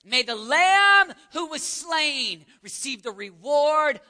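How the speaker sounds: loud drawn-out cry across water. Retold or imitated. Missionaries calling back to friends from the boat, crying out. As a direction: call it out slow and loud to those left behind. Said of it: "As they cried out back".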